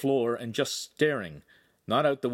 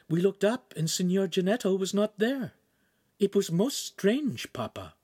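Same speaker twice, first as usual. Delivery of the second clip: put-on higher, puzzled girl voice; 10 semitones higher than usual. A narrator's reading. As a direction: wonder aloud to Papa. Girl tells father.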